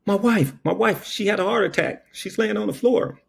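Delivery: high, frantic "my wife"; panicked old man. Retold or imitated. Old man begs the officer at the door. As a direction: plead in a rush of panic.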